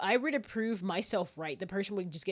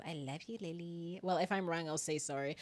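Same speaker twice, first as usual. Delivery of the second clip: ordinary talk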